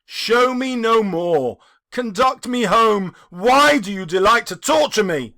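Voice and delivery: loud, pained begging; anguished plea. A narrator's reading. Scrooge begging the ghost to take him home, anguished. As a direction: plead loudly to be spared. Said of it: "said Scrooge".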